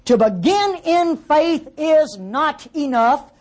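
slow, high, driven home; "To begin in faith is not enough." stretched out and lifted; emphatic slow point. Real, not acted. Preacher's key point.

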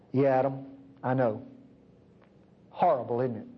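a hand on Adam's shoulder, tender and low; God comforting Adam gently. Acted.

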